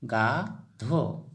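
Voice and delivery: गाधो drawn out very slowly; slow drilled word. Shown as a demonstration. Teacher repeats गाधो for pupils, very slow. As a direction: stretch गाधो for learners. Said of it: "Asamiya में गाधो कहेंगे".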